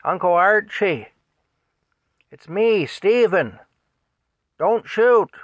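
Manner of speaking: shouted high and loud across a field; yelling. Retold or imitated. Passenger shouting across the pasture to his uncle, loud desperate yell. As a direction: yell it out to stop the shooting. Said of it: "He yells".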